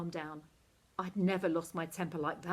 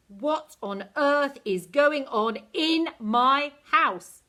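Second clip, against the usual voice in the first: slow, booming, much louder roar; thundered; 7 semitones higher, 12 dB louder, much slower than usual. A narrator's reading. Queenie roars at the children in her cellar, slow booming. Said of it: "she thundered".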